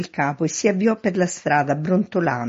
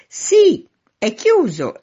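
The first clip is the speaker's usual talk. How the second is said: high, loud call upward; shouted answer; 13 semitones higher, 9 dB louder, much slower than usual. A narrator's reading. Man in street answers the man at the window, shouting. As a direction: shout it up so it carries. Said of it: "sempre gridando".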